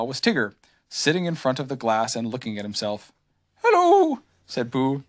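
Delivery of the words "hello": small gentle bear. A narrator's reading. Pooh voiced.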